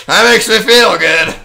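loud, high burst of delight; gleeful shout. Real, not acted. Streamer happy that she follows him, gleeful shout. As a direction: belt it out, pleased with yourself.

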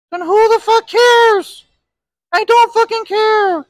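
loud, high, slow fed-up yell; exasperated outburst. Real, not acted. Speaker losing patience with online drama, loud yell. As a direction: blow up in disbelief. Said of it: "exasperated outburst".

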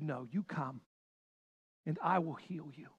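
Jesus quietly calling the lame to come; tender invitation. Acted. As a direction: invite them in softly, like a healer. Jesus welcomes the blind and lame.

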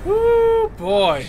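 high shrieking woo; victory whoop. Real, not acted. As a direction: whoop with joy.

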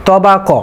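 "তবাক" as to-baa-ko, heavy T and K; correct heavy pronunciation. Shown as a demonstration. Teacher giving the correct pronunciation, heavy to-baa-ko for "তবাক". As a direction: round the first and last syllables of "তবাক" to a full o. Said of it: "সঠিক উচ্চারণ হবে".

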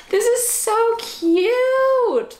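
high, loud slow squeal; delighted squeal. Real, not acted. Opening a cookie tin for her dog, high slow squeal.

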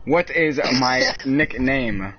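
word by word, drawn out; slow, over-enunciated question; slow, deliberate. Real, not acted. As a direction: spell it out slowly for a dim listener. Slow repeat after assistant fails.

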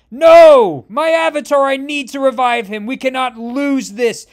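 huge opening No then high, loud ranting; cornered god screams at its foe; furious yell. A narrator's reading. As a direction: roar your loss at the enemy. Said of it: "as Ammit yells".

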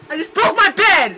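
loud, high, slow stunned shout; shocked shout. Real, not acted. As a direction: shout it in disbelief. Contrast with calm retelling.

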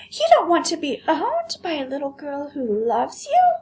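high astonished doll voice; can't believe anyone wouldn't want a buyer; scandalized china doll, high; shocked vain doll. Acted.

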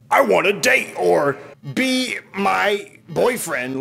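high, slow put-on voice; mock scam voice. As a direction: coo it like a fake dating bot. Mocking scam profile lines.

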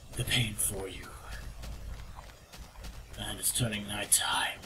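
soft breathy whisper. Pretend. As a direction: whisper it close to the mic. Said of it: "Why am I still talking like this?".

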